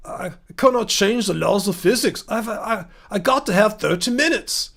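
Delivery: amateur Scottish brogue as a starship engineer; imitated Scottish burr; Scottish accent. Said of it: "read that in a Scottish accent".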